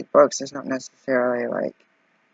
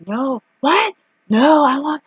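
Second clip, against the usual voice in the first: mock frustration; 12 semitones higher, 6 dB louder, slower than usual. Frustrated at counter.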